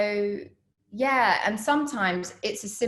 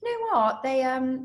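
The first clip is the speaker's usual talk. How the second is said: lightly voiced casting director, high on you know what; a hint of the casting director breaking news; casting director springing a change on her, higher; 7 semitones higher, 3 dB louder than usual. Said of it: "was like".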